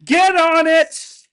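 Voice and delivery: loud, high-pitched bossy shouting; yelling dictator. Acted. Cohost mimicking partner's demands on kitchen cabinets, yelled. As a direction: bellow orders like a mock dictator, drawn out. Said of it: "Why are you yelling so loud?".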